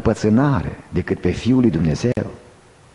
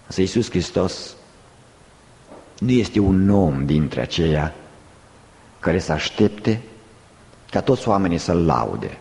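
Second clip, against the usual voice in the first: solemn preaching; 8 semitones lower than usual. Real, not acted. Preacher correcting listeners' false thought, solemn preaching. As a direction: bring it down low, pausing between phrases.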